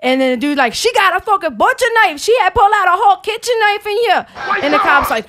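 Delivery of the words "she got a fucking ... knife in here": panicked man. Retold or imitated. Man telling the cop about the knives, high and frantic.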